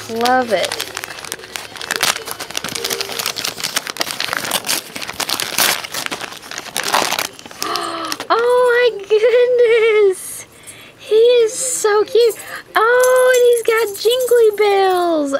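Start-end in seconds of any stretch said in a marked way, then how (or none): 0.7-8.3 sharp breathy gasp of delight. Real, not acted. Sees the ornament. 8.3-15.3 delighted gushing. Real, not acted. Gushing over cute ornament.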